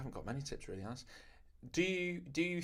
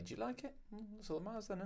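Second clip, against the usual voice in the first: higher, softer sing-song; soft high question; 13 semitones higher, 10 dB quieter, faster than usual. Retold or imitated. Tattooist coaxing a customer, soft and high. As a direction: coo it gently and high. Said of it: "So you just say".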